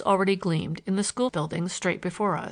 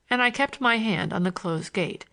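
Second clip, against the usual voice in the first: plain reading voice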